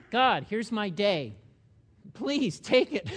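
slow, loud, pleading prayer; mock weary plea. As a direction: hand the day over with a groan. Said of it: "When you wake up in the morning".

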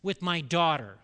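loud protective dad fuming inside; angry inner vow. Retold or imitated. Dad fuming about the girl who teased his daughter, building anger. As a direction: build to a loud threat at the end. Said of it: "I'm mentally under thinking".

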